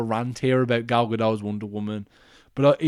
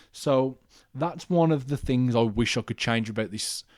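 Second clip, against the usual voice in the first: ordinary delivery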